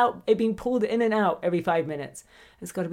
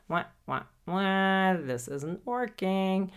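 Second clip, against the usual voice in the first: mock whine; much slower than usual. Mock whining about failure.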